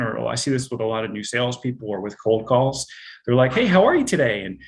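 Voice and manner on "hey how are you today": high, eager greeting that lifts at the end; rising upspeak. Retold or imitated. Insecure new salesperson greeting a cold call, rising upspeak. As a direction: sound anxious to be liked, tune rising. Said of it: "going up at the end of their sentences".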